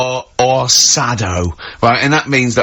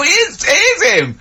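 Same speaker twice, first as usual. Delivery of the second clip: much higher, laughing mock excitement; excited bystander. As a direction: squeal like a thrilled onlooker. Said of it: "And they go".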